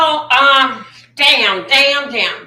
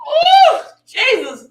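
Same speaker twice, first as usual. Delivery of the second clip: much higher, slow shocked cry; shocked shout; 10 semitones higher, slower than usual. Real, not acted. Reacting to the footage with a shocked cry. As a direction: cry out in disbelief.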